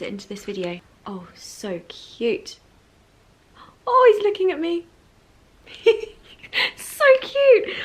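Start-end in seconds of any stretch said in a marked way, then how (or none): 1.1-2.6 slow, hushed adoring coo; real hushed delight at something cute; soft cooing. Real, not acted. Cooing over robin at window. 3.9-7.8 very high, excited cooing; high-pitched gushing over something adorable; delighted squeal. Real, not acted. Thrilled that robin looks at her.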